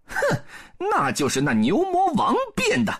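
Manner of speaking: high-pitched confident declaration; scoff, then announce the duel up high; Monkey King voice. A narrator's reading. Sun Wukong vows to duel Bull King, high confident voice.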